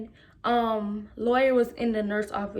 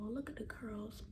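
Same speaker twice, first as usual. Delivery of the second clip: breathy whispered aside; 2 semitones lower, 20 dB quieter than usual. Real, not acted. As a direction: whisper it to a friend.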